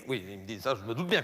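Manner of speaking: low, soft director's reply. Acted. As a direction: drop low and quiet to play the director. Le directeur interrompt le créatif. (directeur de télé, grave et bas)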